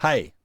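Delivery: "hey" begun with an audible h; isolated demo of "hey" with h breath; rough breathing demo. Shown as a demonstration. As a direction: sound the h at the front of "hey". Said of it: "h sound".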